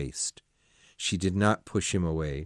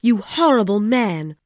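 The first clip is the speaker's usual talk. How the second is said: loud, high furious shout; angry yell; 11 semitones higher, 8 dB louder than usual. Acted. Woman yelling at the man at her window, furious. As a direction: yell it at him in outrage. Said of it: "she yelled".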